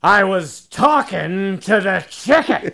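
put-on loud, high drunken holler, drawn out; slow, slurred drunk shouting the punchline; drunk husband. Drunk husband snapping back at his wife, loud slurred bellow. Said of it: "he's drunk, and he says".

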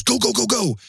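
high, urgent go go go. Retold or imitated. Boss hiding in back seat urging him, high urgent.

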